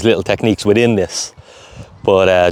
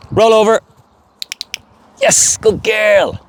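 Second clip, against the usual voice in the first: high, slow drawn praise; command and praise; 11 semitones higher, much slower than usual. Real, not acted.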